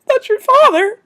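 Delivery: telling her daughter gently, voice lifted high; tender mother reveal. Retold or imitated. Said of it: "And she's like".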